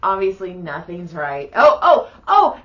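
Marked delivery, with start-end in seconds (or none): 1.5-2.6 alarmed oh. Retold or imitated. Sudden alarmed outburst mid-story.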